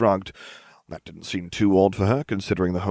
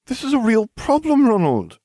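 high, loud exasperated protest; crying out; 7 dB louder than usual. A narrator's reading. Hermione scolds Ronald over the problem, crying out. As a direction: cry out in indignation. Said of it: "she cried".

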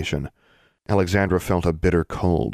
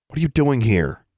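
higher-pitched sharp demand; 7 semitones higher, 3 dB louder than usual. A narrator's reading. Alexandra confronts Hela in the tunnel, demanding. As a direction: demand an answer, voice raised in pitch. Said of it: "Alexandra demanded".

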